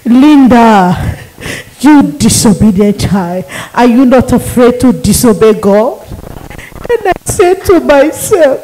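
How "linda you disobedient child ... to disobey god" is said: demon voice. Retold or imitated. Demon scolding Linda in vision.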